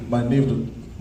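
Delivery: ordinary delivery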